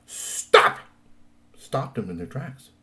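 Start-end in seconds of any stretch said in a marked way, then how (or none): 0.0-0.8 sharp loud hissed stop; word of command. A narrator's reading. Troll princess commanding werewolves, sharp hissed stop. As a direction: bark the order to freeze them. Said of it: "the word of command".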